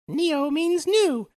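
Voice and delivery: very high, slow little bug; cartoon bug voice. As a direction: squeak it out slowly like a cartoon insect. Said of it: "one of them says".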